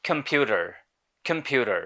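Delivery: American accent with the final r sounded; American pronunciation. Shown as a demonstration. Teacher contrasts two accents, American second. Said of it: "in American English it is pronounced as".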